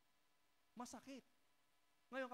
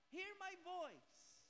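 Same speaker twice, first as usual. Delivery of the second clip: slow, drawn-out Bible quote; slow scripture quote; 6 semitones higher, much slower than usual. Acted. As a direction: savor each word of the verse. Quoting Bible slowly. (mabagal na pagsipi ng Bibliya)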